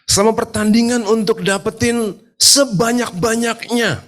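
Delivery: high, urgent sermon voice; impassioned preaching. Real, not acted. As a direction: preach it hard, pitched well up, stretching key words. Preaches with rising force. (khotbah berapi-api dengan nada tinggi)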